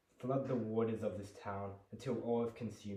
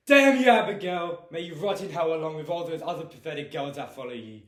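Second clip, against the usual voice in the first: full-throated raging scream at Abigail, much higher and louder; furious shout; 8 semitones higher, 17 dB louder than usual. Acted. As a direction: curse her with everything you have. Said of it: "to anger and disdain".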